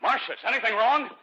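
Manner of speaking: high, worried call into the dark. Acted. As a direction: call out for her, alarmed. Husband calls out downstairs at night.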